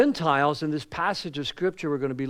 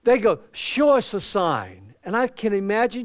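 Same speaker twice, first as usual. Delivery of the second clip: higher, louder, slowed preaching; preacher climbing into the story; fired-up preaching; 6 semitones higher, 6 dB louder than usual. Acted. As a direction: lift it into the pulpit voice. Preaching builds up.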